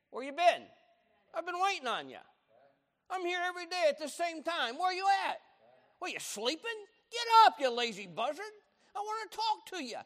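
high, loud, nagging grandma voice; scolding grandmother. Retold or imitated. God imagined as grandmother scolding a late grandson, high and loud. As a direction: badger him like an Italian grandma. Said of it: "That's how my grandmother would have talked to me".

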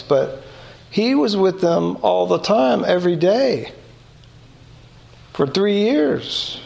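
ordinary talk

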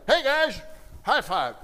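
bright, loud pump-up call; hyped-up greeting. Retold or imitated. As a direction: fire up the team with a big hello. Pastor mimics Steve hyping players.

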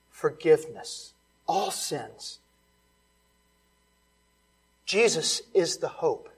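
normal talking voice

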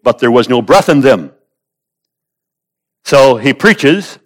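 emphatic preacher, voice lifted; forceful preaching. Real, not acted. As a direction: press the point home to the congregation.

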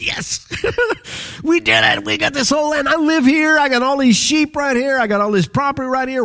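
high, gleeful outburst with a laugh; thrilled young listener. Acted. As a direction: act out a boy elated by hero stories. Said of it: "the heart of David as he listens to those hero stories".

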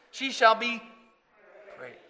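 loud call for the congregation to repeat. Shown as a demonstration. Preacher calling the verse out to the congregation, loud. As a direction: lead the church in the reading. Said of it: "Can we say that again?".

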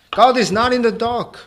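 high, loud proclamation as Paul; forceful rebuke. Acted. Preacher voicing Paul's rebuke.

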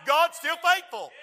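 slow, high declaration of faith; preached refrain. Acted. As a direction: lift "faithful" up and lean on it. Refrain repeated at climax.

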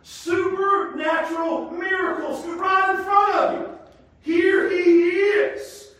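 loud, high preacher's climax; preacher crying out that Jesus is the one; preaching at full force. Acted. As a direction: hammer each line home to the congregation.

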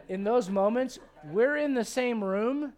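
usual speaking voice

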